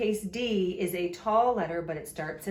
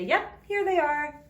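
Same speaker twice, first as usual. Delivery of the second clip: high, louder cheerful reply; upbeat, pitched-up "found them" voice; family member answering; 8 semitones higher, 6 dB louder than usual. Acted. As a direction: answer like a helpful relative. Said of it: "mom or dad or your sister or your brother would say".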